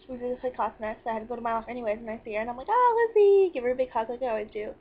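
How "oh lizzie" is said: high, loud squeal of hello; excited greeting. Retold or imitated. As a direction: call out to a friend, thrilled. Greeting a friend on sight.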